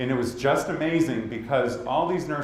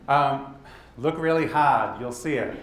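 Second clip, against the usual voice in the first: usual speaking voice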